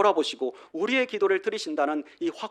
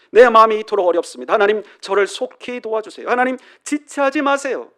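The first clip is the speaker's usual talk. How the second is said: urgent plea; 4 semitones higher, 8 dB louder than usual. Pretend. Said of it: "그렇게 기도하고 있는 것입니다".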